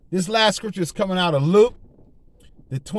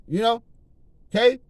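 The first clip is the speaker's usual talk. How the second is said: usual speaking voice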